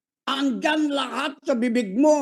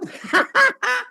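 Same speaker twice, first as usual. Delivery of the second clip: high burst of laughter mid-shout; high excited laugh; 4 dB louder than usual. Real, not acted. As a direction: laugh out in the heat of preaching. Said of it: "Napapasigaw na ako dito".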